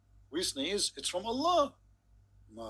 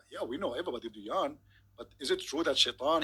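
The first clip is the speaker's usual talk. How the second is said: ordinary talk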